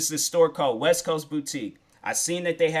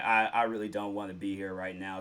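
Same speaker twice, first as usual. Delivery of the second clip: glum mumble; 7 semitones lower, 8 dB quieter than usual. Retold or imitated. Retold glum wish to leave.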